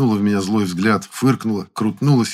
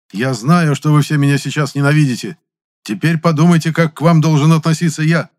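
loud, higher, indignant lecturing; angry scolding; 7 semitones higher, 6 dB louder than usual. A narrator's reading. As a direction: scold them like an angry bandmaster. Angry Zyozik scolds trumpeters. (сердитая отповедь)

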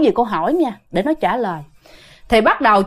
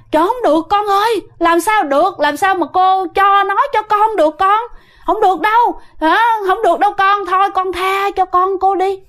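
higher, urgent begging; a mother pleading, voice raised high; 7 semitones higher than usual. Retold or imitated. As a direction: beg the spirit to spare her son.

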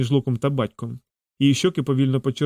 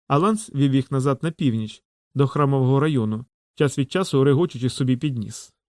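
plain reading voice

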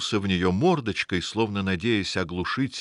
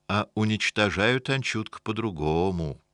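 usual speaking voice

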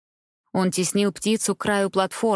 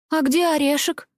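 small fairy voice; 8 semitones higher than usual. A narrator's reading. Fairy asks anxiously.

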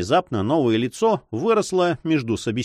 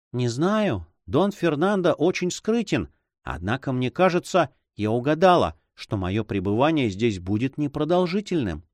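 higher-pitched woman's character voice; higher, tentative lady's voice; uncertain heroine; 4 semitones higher than usual. Acted. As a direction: voice a heroine guessing at her fate.

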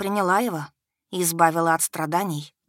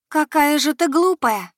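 serenely smiling; 9 semitones higher, 6 dB louder than usual. A narrator's reading. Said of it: "безмятежно улыбнулась девчонка".

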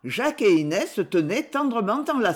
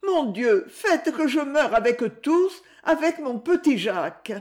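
pleading prayer; 7 semitones higher than usual. A narrator's reading. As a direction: beg God to let her die with them. Inès prays in despair.

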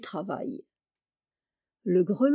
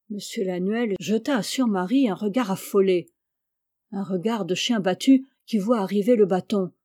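plain reading voice

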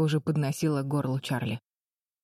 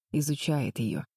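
plain reading voice

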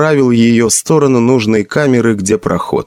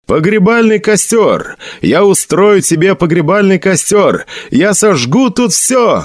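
hit hard crying out; 9 semitones higher than usual. Acted. Сэджин выкрикивает клятву отцу.

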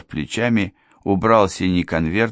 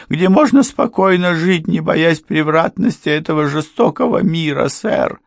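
much higher, louder, ingratiating plea; fawning plea; 10 semitones higher, 6 dB louder than usual. A narrator's reading. Housekeeper begging Ford and Miss Trevick, high and fawning. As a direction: throw yourself on their mercy. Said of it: "housekeeper begs for mercy".

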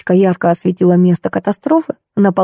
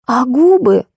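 high, mildly cross follow-up; still worked up; 5 semitones higher, slower than usual. A narrator's reading. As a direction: press the grievance, pitch raised. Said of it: "не успокаивалась Зая". (не успокаиваясь, высоким обиженным голосом)

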